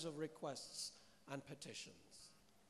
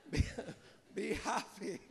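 a word through a breathy laugh. Real, not acted. Preacher laughing at her own joke.

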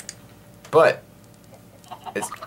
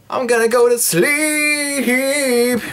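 slow and much higher; high sleepy sing-song; 10 semitones higher, much slower than usual. Pretend. Gamer heading to bed, slow high sing-song. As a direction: drawl it high like a lullaby.

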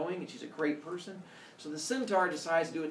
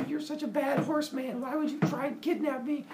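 scolding damsel; 11 semitones higher than usual. Acted. Daenerys voiced yelling at centaur.

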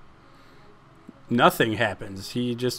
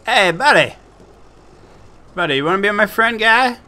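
much higher, louder silly voice, fast; goofy taunting voice; 9 semitones higher, 6 dB louder than usual. Pretend. Gamer taunting an enemy he's about to kill, goofy high voice. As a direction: play a dopey fake-friendly creep.

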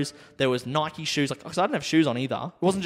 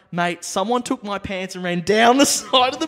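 higher and louder, breathless appeal; frantic pleading; 9 semitones higher, 6 dB louder than usual. Retold or imitated. Begging the smokers to let him pass, frantic. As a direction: beg them urgently, half laughing. Pleading with strangers, pants lost.

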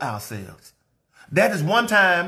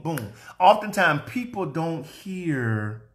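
ordinary talk

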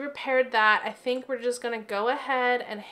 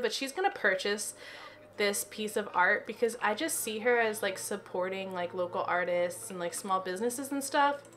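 ordinary delivery

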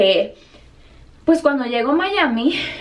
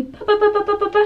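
imitated busy typing noise; high, monotone string of pa sounds; high rattled-off "pa-pa-pa"; 11 semitones higher than usual. Imitating the agent processing the ticket, high repeated pa sounds.

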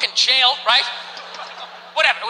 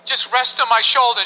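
normal talking voice

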